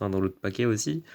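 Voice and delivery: normal talking voice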